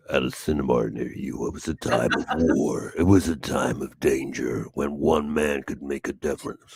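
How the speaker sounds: deep dramatic trailer voiceover; movie trailer voice. Shown as a demonstration. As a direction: intone it like an epic film trailer. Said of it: "get some voiceover work while your voice rivals Morgan Freeman's".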